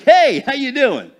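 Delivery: loud, high, cheery hello; hearty greeting. Retold or imitated. Husband flopping down next to his wife, hearty greeting. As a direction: burst in with an eager hey.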